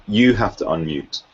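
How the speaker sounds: ordinary delivery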